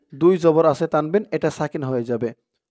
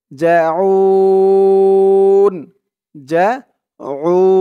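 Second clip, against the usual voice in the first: "জাউন" with a long held u before the n; three-alif elongation; 3 semitones higher, 6 dB louder, much slower than usual. Shown as a demonstration. Teacher shows the stretch, "জাউন" held very long on u. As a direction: stretch the u of "জাউন" in a steady chant. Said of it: "এখানে মধ্যে আরজি তিন আলিফ টান".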